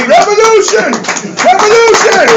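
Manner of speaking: high, rousing call-out; mock crowd shout. Everybody coming to the gas station greets him, high shout. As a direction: hail him loudly with the slogan.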